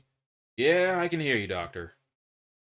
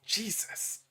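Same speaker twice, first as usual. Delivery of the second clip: horrified whisper; 7 semitones higher, 6 dB quieter than usual. Acted. Finds a tiny shoe in tunnel.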